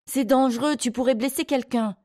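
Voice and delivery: high-pitched urgent warning; cried warning. A narrator's reading. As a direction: warn a child off in alarm. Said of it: "cria-t-elle".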